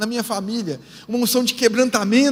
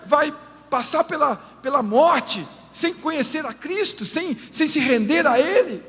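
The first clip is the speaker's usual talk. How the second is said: fervent preaching; 4 semitones higher than usual. Acted. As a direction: press the congregation with urgency. Preaching to the congregation.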